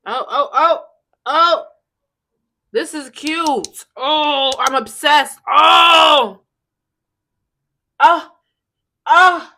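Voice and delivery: high, loud gasping oh's; excited cries. Real, not acted. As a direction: cry out in delight. Reacting to product stinging eyes.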